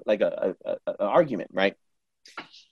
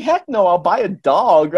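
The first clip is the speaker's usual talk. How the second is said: higher, louder, drawn-out refusal; comic retort; 5 semitones higher, 5 dB louder, slower than usual. Retold or imitated. Client turning down card reader's offer of love, comic retort. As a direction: refuse with playful emphasis.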